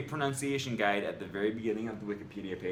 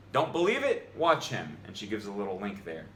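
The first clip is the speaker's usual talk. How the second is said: ordinary talk, nothing marked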